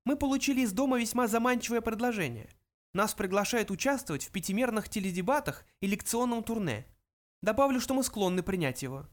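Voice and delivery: high little mouse voice; high-pitched small creature. A narrator's reading. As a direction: state it as a tiny mouse. Mouse character continues.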